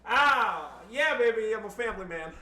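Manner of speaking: high, loud cheesy brag; playful fake swagger; mock excited. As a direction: ham it up like a smug showman. Mock boast after background noise.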